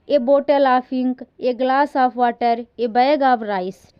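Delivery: slow, clear classroom dictation. Shown as a demonstration. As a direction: read it out word by word for the class. Teacher reads out example phrases.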